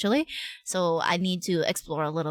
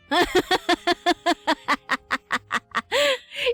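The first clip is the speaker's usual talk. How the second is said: long, high cackling laugh; 9 semitones higher than usual. Real, not acted. Streamer recalling the shut-down top-up, laughing. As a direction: keep laughing in a long run.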